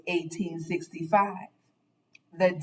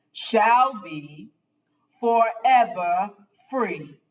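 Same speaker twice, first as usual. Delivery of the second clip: formal proclamation; 4 dB louder than usual. Acted. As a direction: read it like a solemn decree. Reading the proclamation aloud.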